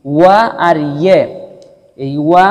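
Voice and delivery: ordinary talk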